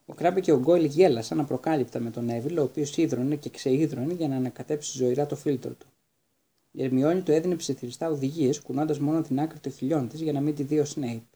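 ordinary delivery, nothing marked

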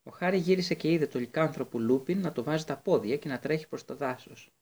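ordinary delivery

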